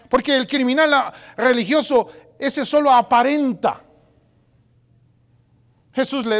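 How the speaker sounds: loud, high preaching burst; fiery preaching. Real, not acted. Pastor thundering at his church, loud and high. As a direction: hammer the point home to the congregation.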